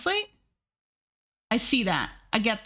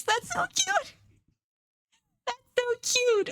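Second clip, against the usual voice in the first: very high, squeaky cooing; squealing with delight; 13 semitones higher than usual. Real, not acted. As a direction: squeal at how cute it is. Squealing over cute snail.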